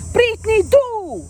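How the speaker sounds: high, loud, curt command; imitating coach order. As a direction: bark the order impatiently.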